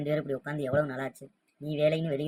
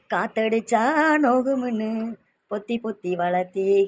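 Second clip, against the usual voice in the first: higher, louder and slow, wailing through tears; crying lament; 6 semitones higher, 8 dB louder, slower than usual. Acted. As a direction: pour out grief to his sick mother, sobbing. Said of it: "அழாத கண்ணு". (அழுகையுடன் புலம்பல்)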